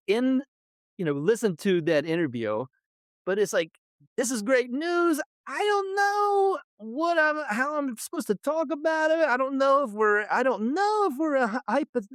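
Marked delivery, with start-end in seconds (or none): 4.2-12.1 high-pitched, flustered protest; acted bewilderment. Host voicing a torn fan's reaction to great news, flustered. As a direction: stumble through it, pitched way up.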